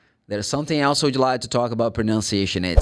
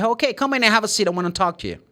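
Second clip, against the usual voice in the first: fast, higher welcome; hosting a visitor; 7 semitones higher, much faster than usual. Acted. A host invites a knocking visitor in, quick and higher. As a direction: hurry them to a chair. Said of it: "and I'm gonna say".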